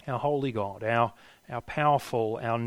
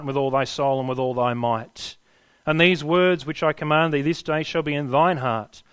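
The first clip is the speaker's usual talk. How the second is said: loud, ringing recital of scripture; scripture recitation; 3 semitones higher, 5 dB louder than usual. Acted. Father reciting scripture to his boys, ringing and loud. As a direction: proclaim it like a father teaching. Recites Deuteronomy passage with force.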